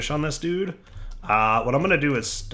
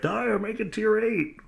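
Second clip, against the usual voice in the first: high, slow chanting demand; viewers' demand voice; 10 semitones higher, 3 dB quieter than usual. Retold or imitated. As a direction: call out like a fan crowd. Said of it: "I can already hear it".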